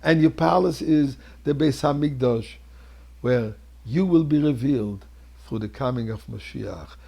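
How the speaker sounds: ordinary talk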